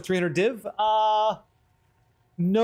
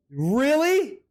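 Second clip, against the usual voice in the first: incredulous; 7 semitones higher than usual. Real, not acted. Reacting to the drop.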